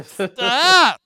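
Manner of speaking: high, loud Stitch laugh; Stitch impersonation. Acted. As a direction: shriek and cackle like Stitch. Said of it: "That's a good laugh".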